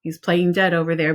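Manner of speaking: plain reading voice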